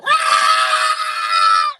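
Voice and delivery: long loud mock engine scream; car screaming. Driver imitating his car's scream, long loud wail. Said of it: "always screaming".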